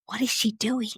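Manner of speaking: hushed, puzzled question; whisper. Retold or imitated. Midwife whispering to a colleague, hushed and baffled. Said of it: "I heard my midwife whisper".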